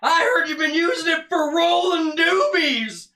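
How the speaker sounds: high, loud, slow bellow; blustering comic impression; Chris Farley. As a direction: stretch out the outraged accusation at full volume. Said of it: "That's a pretty good impression".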